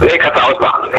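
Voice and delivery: speakerphone echo; tinny echo over the line; phone echo. Real, not acted. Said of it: "wie schlimm ich klinge".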